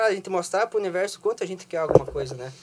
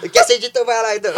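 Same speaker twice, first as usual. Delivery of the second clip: laughing; 4 semitones higher, 10 dB louder, faster than usual. Retold or imitated. Quoting dad through laughter.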